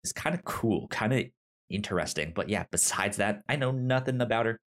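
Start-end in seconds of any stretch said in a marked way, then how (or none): none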